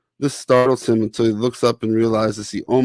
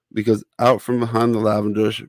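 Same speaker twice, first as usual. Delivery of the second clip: plain reading voice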